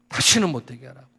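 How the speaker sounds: angry order. Retold or imitated. Pastor ordering the deacon about parking, angry. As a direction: snap the order out in hurt anger. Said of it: "막 내가 이랬어요".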